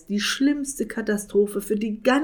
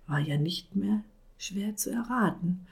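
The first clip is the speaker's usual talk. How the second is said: usual speaking voice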